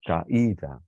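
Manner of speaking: "Caída" as ca, then a strong separate í; split hiatus. Shown as a demonstration. Teacher showing vowels we must separate, split hiatus. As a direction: break "Caída" before the stressed í. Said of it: "las tenemos que separar".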